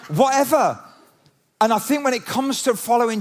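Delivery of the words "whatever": hammed-up camp "whatever", pitched high. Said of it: "going all camp on you".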